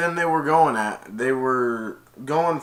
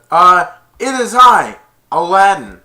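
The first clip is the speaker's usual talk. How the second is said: booming, much louder declaration; loud, higher hero proclaiming his name; loud announcement; 7 semitones higher, 16 dB louder than usual. Retold or imitated. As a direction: announce yourself proudly to the cave. Aladdin answers the cave.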